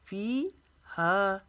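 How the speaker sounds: फ़ीहा slowly, both फ़ी and हा stretched; both vowels long. Shown as a demonstration. Teacher demonstrating two madds, फ़ीहा drawn out slowly. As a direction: hold each vowel of फ़ीहा. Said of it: "इसको भी अलिफ जितना लंबा खींचना".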